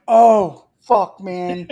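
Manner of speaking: loud, fed-up "oh"; exasperated outburst. Retold or imitated. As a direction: blurt it out, fed up with the clerk. Exasperated at clueless clerk.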